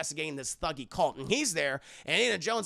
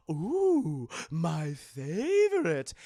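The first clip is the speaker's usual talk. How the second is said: relishing fat guy; 2 semitones lower, much slower than usual. Retold or imitated.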